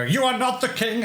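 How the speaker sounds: mock-scary, high-pitched declaiming; hammed-up scary outburst, pitched high; Tyrion Lannister. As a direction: proclaim it like an imperious threat. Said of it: "he's gonna be all scary and go".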